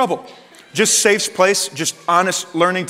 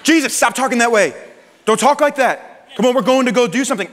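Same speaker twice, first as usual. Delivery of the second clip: higher, urgent scold, half acted; mock reprimand in a raised voice; hushed scolding Peter; 6 semitones higher than usual.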